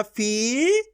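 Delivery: फी held long on a rising tune; teacher sounding out a long फी; stretched long ee. Shown as a demonstration. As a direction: stretch the ee in फी and lift it. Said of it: "खींच खींच के उच्चारण करना है".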